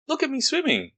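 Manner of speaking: high, proud squeaky little Roo. Acted. Roo's voice in story.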